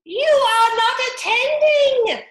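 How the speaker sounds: high, loud, slow squeaky scolding; severe mouse. A narrator's reading. Mouse scolding Alice, severe squeaky voice. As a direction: squeak it out sternly and slowly. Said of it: "to Alice severely".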